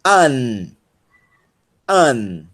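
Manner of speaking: "an" as one isolated, drawn-out syllable; clearly separated "an", repeated after a pause; syllable demo. Shown as a demonstration. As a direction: model the syllable "an" for learners. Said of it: "é pronunciado como N".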